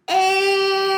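long high-pitched yell of a name; loud yell. Acted. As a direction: yell it out, stretching both syllables. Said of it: "yelled as loudly as he could".

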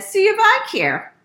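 high, loud dismissive retort; brother's huffy parting shot. Acted. Brother gives up in a huff.

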